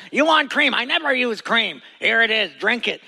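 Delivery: high, pushy offer of cream; Bedouin herder impression. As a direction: shove the cup at them, herder style. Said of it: "they always talk like this".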